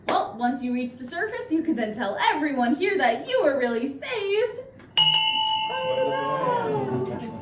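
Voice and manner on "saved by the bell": drawn-out dramatic reveal; slow punchline. Acted. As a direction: stretch it out for the pun. Punchline delivered slowly.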